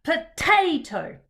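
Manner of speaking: slow, loud mock-angry potato, middle syllable hit hard. Shown as a demonstration. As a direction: stomp on the middle of potato, playfully cross. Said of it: "say potato in an angry way".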